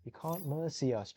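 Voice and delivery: hint of players refusing defeat; lightly voiced defiant players. Caster speaking for the defending team, lightly voiced defiance. And they say.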